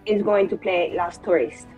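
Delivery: normal talking voice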